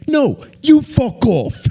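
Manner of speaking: very high, loud, slow mock British bloke; hammed-up British guy firing back; British accent. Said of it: "pull out your British accent again".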